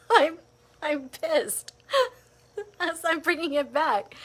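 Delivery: laughing through the words. Real, not acted. Laughing as she admits bringing the anger back. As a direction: giggle it out.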